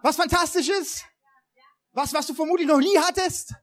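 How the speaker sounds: hyped-up prize announcer, loud and high; game show host. Pretend. Pastor hypes fake prize giveaway.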